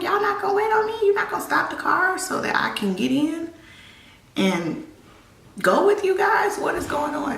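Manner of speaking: confused calling out. Retold or imitated. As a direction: call out to them, bewildered. Jumps into car, confused.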